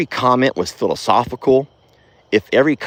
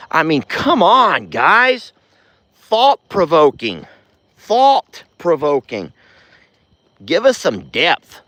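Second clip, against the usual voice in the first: slow, higher exasperated appeal; exasperated plea; 7 semitones higher, slower than usual. Real, not acted. Vlogger pleading with viewers for better comments, slow and exasperated. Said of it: "exasperated plea to viewers".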